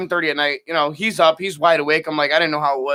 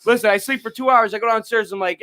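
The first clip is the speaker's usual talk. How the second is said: ordinary delivery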